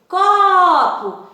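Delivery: loud high call "Copo!", stressing the có; calling out a name. Shown as a demonstration. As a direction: call "Copo!" like a name across the yard, hit the có. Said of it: "seria algo do tipo". (chamando alguém: "Copo!" bem alto, com força no có)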